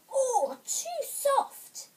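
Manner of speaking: high, slow, drawn-out objection; Goldilocks complaining. A narrator's reading. Goldilocks trying Mother Bear's chair, complaining. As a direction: draw out a high-pitched complaint.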